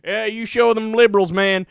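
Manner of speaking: a hint of a cheering idiot; mocking a dumb viewer. Mimicking Fox viewers cheering, lightly voiced dumb yokel. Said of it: "some idiots in the country watching that going".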